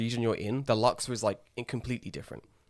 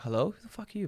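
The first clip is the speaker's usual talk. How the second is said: baffled "Hello?" pitched up high, rising; puzzled inner voice meeting a stranger; confused inner reaction; 9 semitones higher, 3 dB quieter, faster than usual. Retold or imitated. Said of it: "I'm like".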